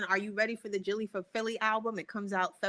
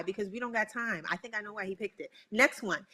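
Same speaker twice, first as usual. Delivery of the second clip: ordinary talk, nothing marked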